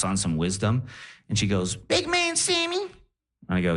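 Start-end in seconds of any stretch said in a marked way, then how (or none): 1.8-2.8 slow, very high, rising comic croon; Cat Williams. Retold or imitated. Grandma grabs his face at goodbye, slow squeaky Def Jam-style address. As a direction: imitate grandma calling him in drawn-out falsetto. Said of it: "she was talking in a crazy accent, like, uh, like Def Jam comedy, like Cat Williams".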